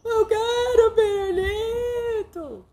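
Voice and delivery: put-on very high, slow wailing child voice; hammed-up child sobbing for candy; crying child. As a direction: whine and cry like a kid denied candy. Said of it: "Ou ela chora".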